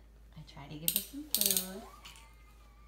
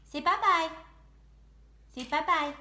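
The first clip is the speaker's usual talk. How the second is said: cheerful loud bye-bye; 6 semitones higher, 12 dB louder than usual. Real, not acted. Loud cheerful sign-off to baby.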